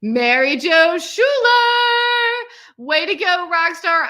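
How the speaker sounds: excited announcement. Real, not acted. Announcing graduate name.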